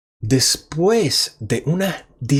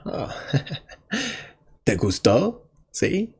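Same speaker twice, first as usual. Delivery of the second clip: put-on smug boyfriend thinking; 7 semitones higher than usual. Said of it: "el hombre piensa".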